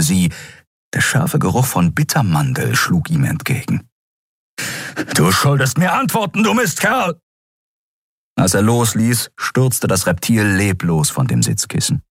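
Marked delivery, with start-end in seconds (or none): 5.1-7.2 hoch und wütend; wütender Schrei. A narrator's reading. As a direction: verzweifelt fluchend. Admiral schüttelt den Toten. (zorniger Admiral)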